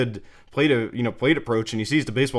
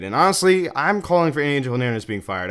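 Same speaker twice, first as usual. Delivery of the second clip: loud, high-pitched indignant lead-in; emphatic appeal; 4 semitones higher, 5 dB louder than usual. Real, not acted. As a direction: launch the demand loudly and high. Launches call for umpire's firing.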